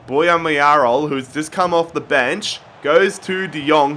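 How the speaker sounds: ordinary talk, nothing marked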